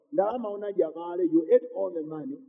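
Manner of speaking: ordinary talk, nothing marked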